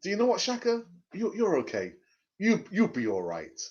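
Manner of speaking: a mild mock of a too-gentle captain; soothing captain. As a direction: pat a teammate on the back with words. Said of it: "some who might say".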